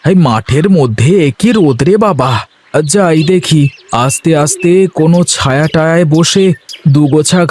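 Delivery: a cheerful farmhand talking to himself under the sun; young farmer Ganesh. Acted.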